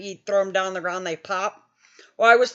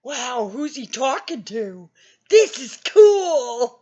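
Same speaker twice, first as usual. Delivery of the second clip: put-on dazed teen who thinks it's a game; clueless wonder; 2 semitones higher, 4 dB louder, slower than usual. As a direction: naive awe breaking into laughter. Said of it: "And I'm like".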